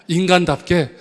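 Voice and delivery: 인간답게 punched loud, falling; loud stressed question. Shown as a demonstration. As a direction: throw out 인간답게 as a loud challenge. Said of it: "인간답게 대답할까요". (인간답게를 크게 던지듯)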